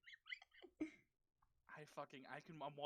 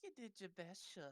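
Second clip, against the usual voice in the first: soft, put-on Southern accent; 4 semitones higher, 7 dB quieter than usual. Friend teased into a Southern line for the chat, in a Southern accent. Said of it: "in your best Southern accent".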